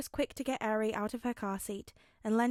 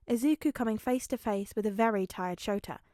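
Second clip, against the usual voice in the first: usual speaking voice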